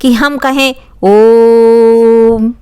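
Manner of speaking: ओम held very long and slow; drawn-out chanted ओम; prolonged vowel. Shown as a demonstration. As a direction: stretch ओम like a chant. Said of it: "प्लुत स्वर में क्या".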